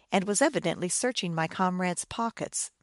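usual speaking voice

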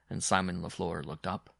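usual speaking voice

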